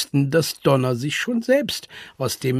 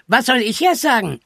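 nasal, higher-pitched indignant voice; 8 semitones higher than usual. A narrator's reading. Charlie refuses to be left out, whining nasally. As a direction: say it through the nose. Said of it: "näselte Charlie Gottchen".

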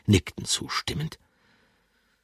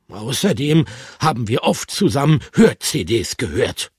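defiant, higher and louder retort; 8 semitones higher, 7 dB louder than usual. Acted. Bertram defiantly answers his friends. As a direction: insist stubbornly. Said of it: "entgegnete er trotzig".

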